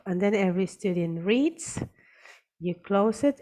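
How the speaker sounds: ordinary talk, nothing marked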